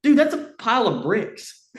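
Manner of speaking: high, loud debunking; retold incredulous correction. Hunter telling dad they are bricks, retold in disbelief.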